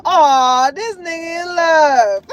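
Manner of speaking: drawn-out teasing mock; teasing friends. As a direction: tease him like a friend. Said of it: "it's like a".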